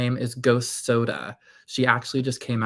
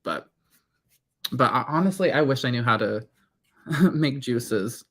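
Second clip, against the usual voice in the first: usual speaking voice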